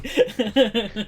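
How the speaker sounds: loud, high, slow cry through laughter; laughing mock echo. Co-host echoing the mocked movie line, laughing loudly. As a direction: parrot it loudly while cracking up.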